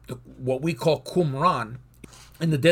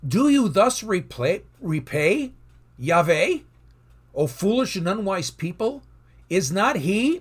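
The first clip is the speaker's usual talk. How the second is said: scripture read higher and louder; emphatic scripture reading; 4 semitones higher, 3 dB louder than usual. Acted. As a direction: proclaim the verse with emphasis. Rereads verse with Yahweh.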